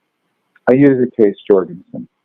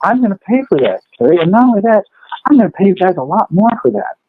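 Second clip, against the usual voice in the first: high, eager buyer who's sold on it; 9 semitones higher than usual. Retold or imitated. A retold buyer agreeing to pay more, high and eager. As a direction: act out a delighted customer, pitched up.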